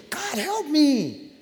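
high, slow, stretched-out cry for help; desperate plea to God. Retold or imitated. Believer crying out to God in trouble, slow desperate plea. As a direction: let "help" hang, pleading upward.

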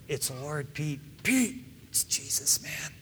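hushed, breathless whisper to Peter. Acted. Disciple whispering to Peter that it's Jesus, hushed. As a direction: lean in and whisper the news.

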